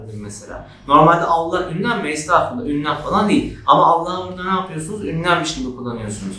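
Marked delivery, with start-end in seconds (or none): none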